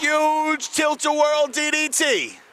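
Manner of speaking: rising, stretched-out excited call; excited commentator call. Real, not acted. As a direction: sell the big move with a slow, swelling call. Big move in the match.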